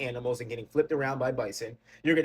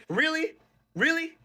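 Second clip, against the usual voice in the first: squeaky, much higher disbelieving really; incredulous really; 14 semitones higher, 3 dB louder than usual. Real, not acted. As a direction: shoot up in pitch in utter disbelief. Incredulous challenge to Latinos.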